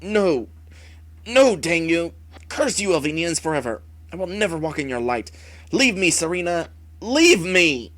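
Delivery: loud, desperate cries of rejection; frantic shout. Acted. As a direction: drive the heroes away in frenzy. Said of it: "Wraith frantically warns off the heroes".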